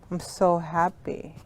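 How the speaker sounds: soft, slow, low "I'm so happy"; mismatched glum happy. Shown as a demonstration. Showing students a mismatched happy, flat and glum. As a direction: say "I'm so happy" like it's bad news. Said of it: "It doesn't match".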